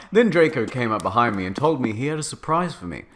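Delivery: plain reading voice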